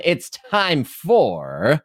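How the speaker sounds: slow, stretched game show intro; drawn-out announcer. Acted. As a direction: drag out the intro.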